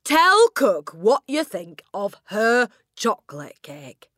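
slow, high, loud command; booming. A narrator's reading. Headmistress ordering the boy to praise cook, booming. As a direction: boom the order, falling on the last word. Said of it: "the Trunchbull boomed".

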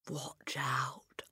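urgent hushed whisper; whispered warning. Acted. Hortensia warns her friends in a whisper. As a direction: breathe the warning under your breath. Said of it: "Hortensia whispered".

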